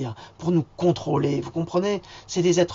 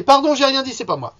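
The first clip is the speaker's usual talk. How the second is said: higher and louder, hammed-up backpedalling; mock apology; 9 semitones higher, 8 dB louder than usual. Taking back his insult about Macron with a mock yelped apology. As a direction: blurt a fake sorry.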